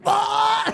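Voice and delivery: high mock cry of disgust; hammed-up high-pitched "ah"; imitated gagging yell. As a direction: yelp like someone hit by fart spray. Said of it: "gagging and getting mad".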